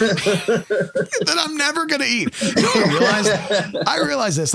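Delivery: high laughing, slow. Real, not acted.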